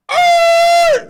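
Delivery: high, loud panicked scream; hammed-up terrified shriek. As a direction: shriek in sudden terror. Panicked as gun drawn.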